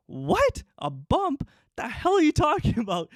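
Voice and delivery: high-pitched retold disbelief; baffled outburst, pitch leaping on the first word; incredulous. Teen told there's a bump on his chin, incredulous.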